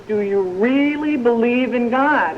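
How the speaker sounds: slow, drawn-out heavenly question; long stretched "really", voice from the sky; voice from above. Acted. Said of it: "And the voice says".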